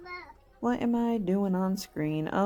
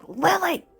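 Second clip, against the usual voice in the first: sharp yell of the name, much higher and louder; snapped yell; 13 semitones higher, 5 dB louder than usual. Retold or imitated. Mom snapping at daughter in the back row, shouting. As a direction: snap and yell her name. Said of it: "I just snapped".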